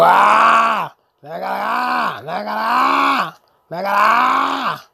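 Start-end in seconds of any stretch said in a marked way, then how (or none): 0.0-0.9 loud raw yell; loud war cry while going Rambo; loud battle yell. Acted. Goes crazy shooting in game. 1.5-2.2 battle yell. Acted. Goes crazy shooting in game. 2.6-3.4 higher, louder shout; high war cry while shooting; high loud battle yell. Acted. Goes crazy shooting in game. 4.0-4.9 higher, louder shout; high war cry while shooting; high loud battle yell. Acted. Goes crazy shooting in game.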